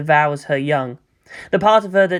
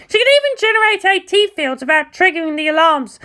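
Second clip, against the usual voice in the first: put-on higher, cocky, blunt girl voice; brash pilot Asuka; 13 semitones higher than usual. Asuka telling Mayumi it's not her battlefield, higher brash voice. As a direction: voice Asuka high and sure of herself, talking down. Asuka explains over comm.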